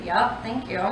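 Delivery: ordinary delivery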